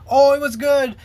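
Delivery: high, loud sing-song parody; hammed-up rosy mimicry; mock cheerful. Mimicking departing members' praise.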